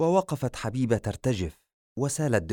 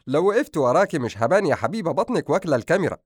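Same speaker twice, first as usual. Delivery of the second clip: laughing, teasing; 6 semitones higher, 5 dB louder, faster than usual. A narrator's reading. Husband teasing his pregnant wife on camera, laughing and quick. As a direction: laugh first, then quick louder teasing. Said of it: "وضحك أدهم وقال".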